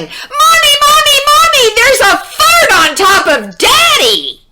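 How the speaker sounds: put-on high, loud panicked child's cry; frantic little kid yelling; child shouting. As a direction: scream for Mommy in alarm. Child screaming for mother.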